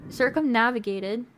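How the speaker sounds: soft, steady storyteller read; quietly narrating a moonlit stroll; story narration. Acted. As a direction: draw the listener into the shoreside scene. Reads the game's narration aloud.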